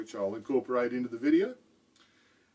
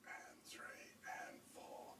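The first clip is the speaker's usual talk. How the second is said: whispered soft counts; 24 dB quieter than usual. Shown as a demonstration. Drum teacher showing soft tap strokes, whispered count. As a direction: whisper the unaccented beats. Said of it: "I'd count it like this".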